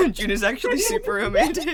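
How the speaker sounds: giggling out the tease; laughing. Real, not acted. Laughing while teasing June.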